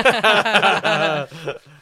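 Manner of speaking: big burst of laughter; loud laughing. Real, not acted. Host cracking up at the Satan Box joke, loud laugh. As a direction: crack up loudly.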